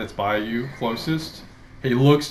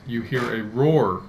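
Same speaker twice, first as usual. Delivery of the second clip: ordinary talk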